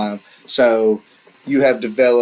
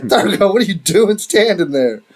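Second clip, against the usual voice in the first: high-pitched, alarmed mock exclamation; voiced surprise, jumping up high; startled player reaction; 9 semitones higher than usual. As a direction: act out suddenly noticing a friend behind you. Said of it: "and you're like".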